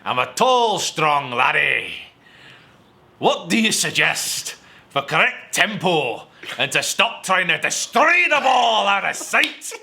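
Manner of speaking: loud, high, hammed-up Scottish accent; over-the-top Scottish accent, cracking up at the end; awful Scottish accent. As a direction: belt it out slowly in a bad Scottish accent. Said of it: "I'm an awful Sc-, uh, Scottish accent".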